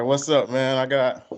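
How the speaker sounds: ordinary talk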